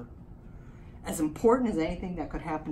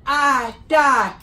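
alien voice; 4 semitones higher, 3 dB louder, much slower than usual. Acted. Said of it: "I got my alien voice".

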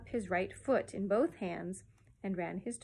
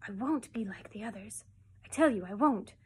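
intense vow, building louder; soft and fierce; 4 dB louder than usual. A narrator's reading. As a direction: insist with childish defiance. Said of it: "called softly and fiercely to Barbara".

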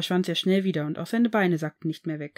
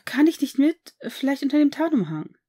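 high, louder whiny plea; whining; 7 semitones higher, 6 dB louder than usual. A narrator's reading. Draco begs his father to come along, whining high and loud. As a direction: beg like a sulking teenager. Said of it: "jammerte er".

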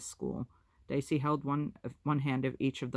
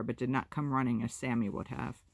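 usual speaking voice

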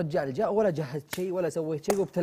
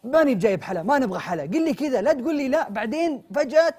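raised, louder telling-off; angry scolding; 7 semitones higher, 6 dB louder than usual. Acted. Yasser scolding Mitab over the missing dessert, loud and raised. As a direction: lecture him for cancelling.

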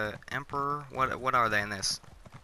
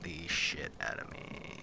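slow whispered admission; hushed confession; 6 semitones lower, 8 dB quieter, slower than usual. Real, not acted. Gamer admitting hag ravens scare him, slow whisper. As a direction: whisper it like a secret fear.

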